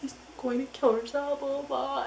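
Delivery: high-pitched pretend wail; hammed-up tearful whine; mock bawling. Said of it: "and I'm bawling".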